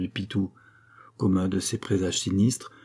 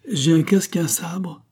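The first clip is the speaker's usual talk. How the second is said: higher-pitched proud boast; 9 semitones higher, 4 dB louder than usual. A narrator's reading. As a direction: declare it with indignant pride. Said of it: "dit-il avec fierté". (fierté indignée)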